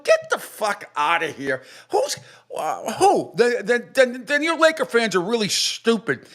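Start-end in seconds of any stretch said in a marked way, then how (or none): 0.0-3.3 slow, scornful brush-off; incredulous dismissal. Retold or imitated. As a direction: wave it away in disbelief. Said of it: "I'm like".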